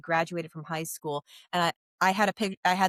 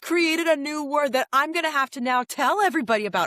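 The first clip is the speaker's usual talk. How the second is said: higher-pitched excited gush; real delight; 7 semitones higher, 4 dB louder than usual. Real, not acted. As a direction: burst out delighted at a great find. Delighted by the newly coined word.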